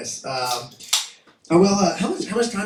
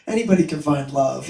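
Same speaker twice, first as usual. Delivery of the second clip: usual speaking voice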